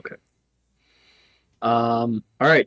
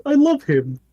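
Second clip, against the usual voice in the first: much higher, gushing over the cute one; 11 semitones higher than usual. Acted. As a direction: squeal it with delight.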